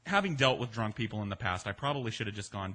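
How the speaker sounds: ordinary delivery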